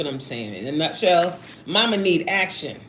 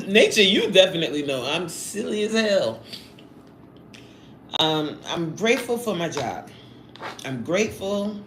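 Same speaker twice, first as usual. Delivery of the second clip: normal talking voice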